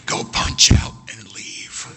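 slow, unvoiced strained order; acted seething, drawn-out command; strained dismissal. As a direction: force the words out slowly, voice gone. Defeated supervisor sends them home.

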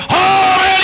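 very high, exultant preacher's cry; excited shout. Real, not acted. Excited cry after the hymns.

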